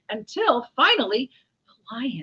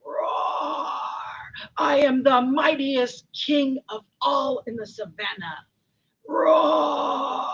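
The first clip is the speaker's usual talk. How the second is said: loud, higher roaring lion king; bragging lion; 6 semitones higher, 6 dB louder, slower than usual. Acted. A lion bragging to all the savannah, boastful roar. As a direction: proclaim it with a drawn-out roar. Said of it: "full of himself and bragging".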